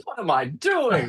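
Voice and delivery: high, strained panicked squeak; mock panic. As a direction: play it like waking up in a cold sweat. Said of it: "in a cold sweat going".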